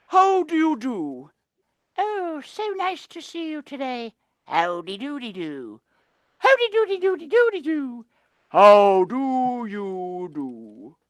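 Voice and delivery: high, prim polite greetings; prim polite penguins. Acted. Polite penguin friends greeting each other each morning. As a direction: mince it like proper little birds. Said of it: "They say".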